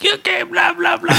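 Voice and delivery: high mock yapping; mouthy backtalk. Kid mouthing off to mom in the car, high yapping. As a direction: yap back like a lippy kid. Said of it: "I'm talking my shit".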